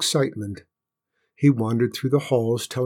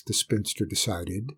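usual speaking voice

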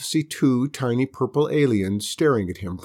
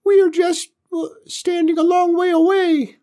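very high, loud squeaky alien; tiny alien voice; 5 dB louder than usual. Acted.

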